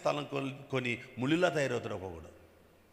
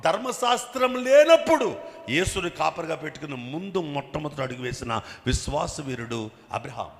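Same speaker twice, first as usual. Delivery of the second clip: loud, high, fervent preaching at full force; preacher stirring the congregation with a raised voice; fiery preaching; 5 semitones higher, 11 dB louder than usual. Acted. Pastor stirring his congregation, preaching at full force.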